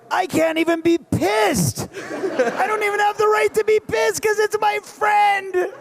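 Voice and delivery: high, comic exasperated yell; rising into a hammed-up frustrated shout; mock outrage. Retelling losing the role to his friend, hammed-up high yell. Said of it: "And I was like".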